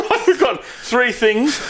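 slow, laughing through the words; can't get it out for laughing; giggling while recalling it. Real, not acted. Laughing while quoting himself.

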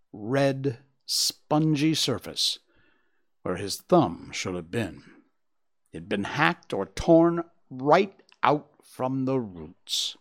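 ordinary delivery

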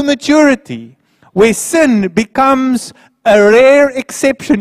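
high, loud, building sermon; full-force preaching. Acted. Pastor urging the church to say no to sin, loud preaching. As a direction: drive it home to the congregation.

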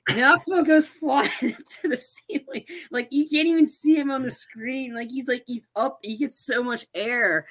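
high-pitched, giggly excitement; excited amazement. Real, not acted. Fan retelling Knoxville flying to the ceiling, giddy amazement. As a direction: marvel at it in a high, laughing voice. Laughing retelling of the big stunt.

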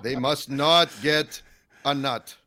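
slow, loud, Yiddish-tinged villain decree; mock Yiddish accent. Podcaster pretending to be Hamas, badly faked Yiddish-sounding accent. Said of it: "making them sound y-, Yiddish".

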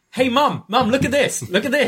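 put-on high, attention-seeking kid voice; mock a child nagging for attention; pestering child. Showing off to Mum, high pestering child voice.

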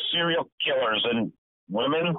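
"women" lifted into a question; slow sign-off ending unsure on "women". Real, not acted. Co-host signing off after serial killers, questioning lift on "women". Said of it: "You can say that in a question".

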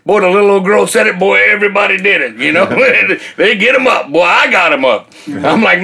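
ordinary talk, nothing marked

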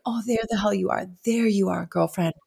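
soft, tender recognition; quiet, affectionate relief; comforting self-greeting. Retold or imitated. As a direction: speak softly to a dear friend.

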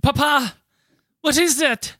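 put-on high, questioning child asking Papa; child's voice. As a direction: play a curious little one, pitched high. Child's part in acted Irish conversation.

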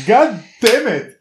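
high, drawn-out exclamation; exasperated outburst. Real, not acted. Host interrupting own explanation, exasperated outburst. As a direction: stretch it out high in frustration.

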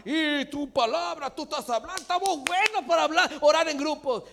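high, loud, hammed-up praying; loud group prayer. Preacher mimicking people who pray in groups, loud. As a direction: belt it out like showy public prayer.

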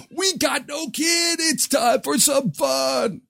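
high, slow whooping shout; wild party shout. Pretend. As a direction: shout with glee at freedom. Voicing parents on night out.

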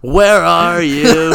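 slow, loud, high cartoon call. Playing a cartoon character.

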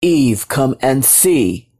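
stately low summons; God. A narrator's reading. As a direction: beckon slowly in a low voice. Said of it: "God's call rippled through the air".

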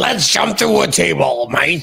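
loud gruff pirate. Retold or imitated. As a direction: growl it like an old pirate. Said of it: "He kinda talked like a pirate".

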